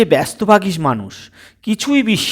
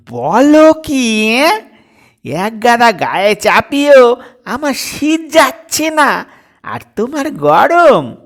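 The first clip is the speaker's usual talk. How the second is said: astonished father; 7 semitones higher, 5 dB louder than usual. Acted. Father surprised daughter feels hot.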